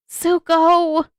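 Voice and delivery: loud high protest of his name; loud high exasperated yell; exasperated shout. Acted. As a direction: call him out, fed up. Katara exasperated outburst.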